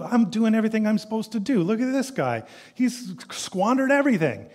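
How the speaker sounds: high, put-on sneering voice; self-righteous whiner. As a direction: scoff at the sinner from a high horse. Mimics self-righteous complainer.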